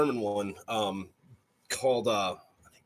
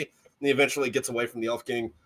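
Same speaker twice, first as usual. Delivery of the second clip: usual speaking voice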